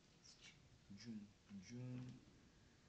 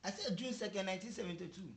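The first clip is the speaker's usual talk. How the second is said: raised, frustrated repeat; loud insistence; 7 semitones higher, 15 dB louder, faster than usual. Real, not acted. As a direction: insist loudly, fed up. Husband insists on his answer.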